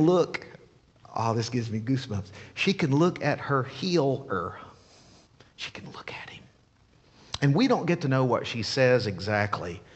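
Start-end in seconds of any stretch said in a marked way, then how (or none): 5.6-6.4 breathless, secret whisper of awe; hushed whisper, almost to herself; awed whisper. Acted. Whispered awe at healed woman.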